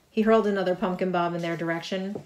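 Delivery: ordinary delivery, nothing marked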